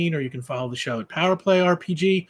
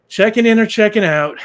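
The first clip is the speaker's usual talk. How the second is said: loud, high, bored front-desk call; brassy motel clerk; 7 semitones higher, 14 dB louder than usual. Acted. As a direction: bark it across the lobby like a clerk. Clerk greets man at desk.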